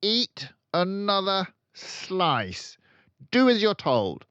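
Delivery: loud, high, slow tyrant order; harsh command. A narrator's reading. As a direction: bark it, brooking no refusal. Trunchbull commanding.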